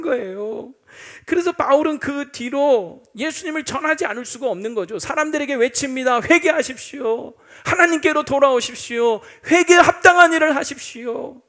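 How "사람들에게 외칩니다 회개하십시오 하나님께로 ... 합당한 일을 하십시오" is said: Paul crying out to the crowd, loud and rising; preaching cry. Acted. Paul urging the people, crying out to repent. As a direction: call the crowd to repent, full voice. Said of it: "하면서 외친 거예요".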